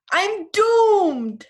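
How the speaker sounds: a touch of hammed-up alarm; mock dread. Elephant's dread voiced in a story.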